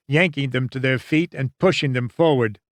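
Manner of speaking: usual speaking voice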